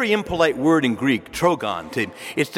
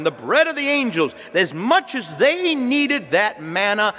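fervent preaching; 4 semitones higher than usual. Acted. Preacher voicing the Lord's bread of life discourse, fervent. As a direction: voice the Lord pressing the point home. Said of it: "and he says here".

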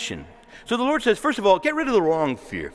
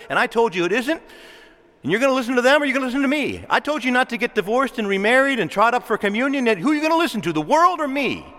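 God scolding his people, raised and pleading; voice of the Lord, stern; 4 semitones higher than usual. Acted. Preacher voicing God to the congregation, stern and raised. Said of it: "The Lord says".

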